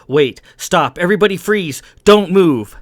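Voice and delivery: loud, high-pitched warning; alarmed, forceful command; urgent cry. A narrator's reading. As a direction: cry out to halt everyone at once. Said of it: "the Duke cried".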